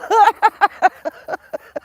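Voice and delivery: very loud, high howl of laughter. Real, not acted. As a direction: throw your head back and howl. Laughing fit.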